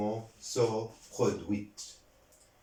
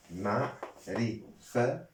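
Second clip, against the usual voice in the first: "معرفة" slowly broken into separate syllables; slower than usual. Shown as a demonstration. Teacher sounding out a grammar term for learners, syllable by syllable. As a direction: spell out "معرفة" in slow, spaced syllables.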